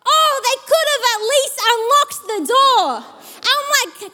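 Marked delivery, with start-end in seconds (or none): 0.1-2.9 high, whiny angry gripe; annoyed complaining. Retold or imitated. Complaining on the doorstep, annoyed and high. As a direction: moan that they could have at least tried. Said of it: "complaining, I'm angry".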